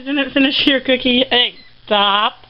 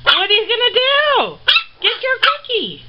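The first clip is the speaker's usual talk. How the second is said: much higher cutesy voice cheering the rat on; 11 semitones higher than usual. Real, not acted. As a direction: egg the rat on in a tiny voice. Baby voice voicing to rats.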